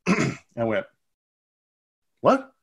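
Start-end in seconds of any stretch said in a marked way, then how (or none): 2.2-2.5 sudden high startled what. Retold or imitated. Teammate asks about a girl, startled what. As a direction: jump at the question.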